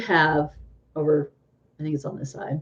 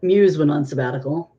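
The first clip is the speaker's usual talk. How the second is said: usual speaking voice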